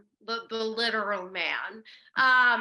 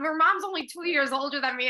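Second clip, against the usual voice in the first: usual speaking voice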